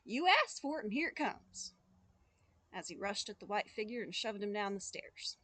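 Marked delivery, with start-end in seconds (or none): 0.1-1.7 loud burst that settles at the end; yelling. Acted. Boy charging at the ghost, mild yell. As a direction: yell it, then follow through. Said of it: "he yelled".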